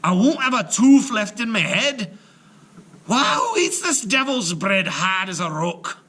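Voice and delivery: loud high gruff giant lament; aggrieved giant. Acted. Giant ranting to Una about the bread, loud. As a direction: rant like a giant losing teeth.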